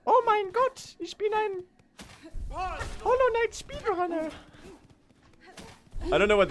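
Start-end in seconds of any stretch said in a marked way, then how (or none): 0.0-4.3 very high squeaky German accent; hammed-up high German voice, dragging the last words; mock German speedrunner. Shown as a demonstration. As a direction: squeal it like an overwrought German gamer. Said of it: "And there's that".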